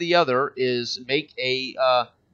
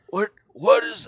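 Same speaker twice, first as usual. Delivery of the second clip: put-on startled drunk, pitched up; surprised drunk; 7 semitones higher than usual. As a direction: stammer like a drunk caught off guard. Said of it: "kinda surprised in a drunken state".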